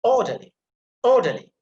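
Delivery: Orderly with OR punched high and loud; emphasis on first syllable. Shown as a demonstration. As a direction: punch OR, fall away through the rest of Orderly. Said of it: "put emphasis on the first syllable".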